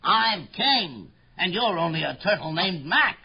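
boastful royal tirade; thundering royal voice. Acted. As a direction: lay down the law to a lowly subject. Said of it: "His royal voice thundered".

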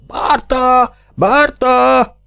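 high, drawn-out call across a theatre; calling out from the auditorium; shouted call. A narrator's reading. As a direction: yell the name high and long. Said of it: "Ordítás a nézőtérről".

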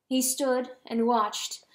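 ordinary delivery, nothing marked

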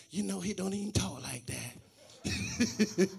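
a lightly played knowing woman, drawn out; imitating the ladies. Pastor mimicking women doubting a suitor, in a slow, soft, amused voice. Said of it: "the ladies be like".

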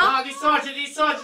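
high, very loud urging; real screaming. Real, not acted. Streamer urging partner to dissociate, screaming. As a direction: scream the order frantically. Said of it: "Beh, abbiamo urlato".

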